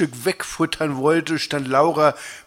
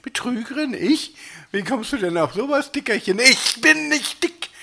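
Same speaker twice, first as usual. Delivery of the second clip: indignant; 9 semitones higher than usual. Acted. Accused partners quarrel, indignant replies.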